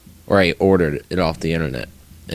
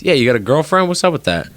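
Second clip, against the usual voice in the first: lightly voiced teammate, pitch up; a hint of a nosy buddy, half-joking; mock Cameron voice; 7 semitones higher, faster than usual. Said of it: "He goes".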